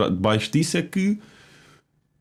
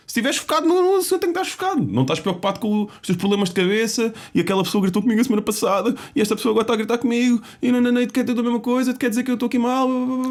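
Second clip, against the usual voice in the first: high mocking inner voice; 9 semitones higher, faster than usual. Voicing anxious inner thoughts.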